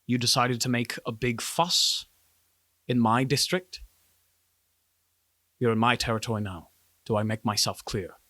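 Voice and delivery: controlled warning with long pauses; stern guard chief. Acted. Guard chief warning private eyes in her district, sternly controlled. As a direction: assert your authority without raising your voice.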